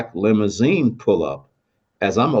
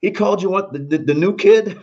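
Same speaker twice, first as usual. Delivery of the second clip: mock amazed coworkers; 9 semitones higher than usual. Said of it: "they're all laughing".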